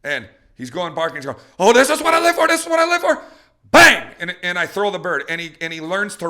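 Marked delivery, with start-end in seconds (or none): 1.6-3.2 very high, loud, frantic doggy shout; screaming excited dog. Acted. Teased dog held back behind trainer, excited screaming. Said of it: "he's gonna be screaming". 3.7-4.1 explosive loud, high bang; gunshot imitation. Trainer firing as the dog screams, explosive bang.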